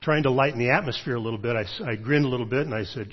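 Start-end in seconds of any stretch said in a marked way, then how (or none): none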